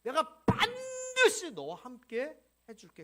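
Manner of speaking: God stressing the certainty of 반드시 to Moses. Acted. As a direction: lean hard on 반드시 and stretch it. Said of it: "이 반드시에 대한 얘기".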